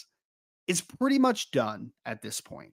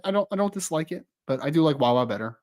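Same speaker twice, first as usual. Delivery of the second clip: usual speaking voice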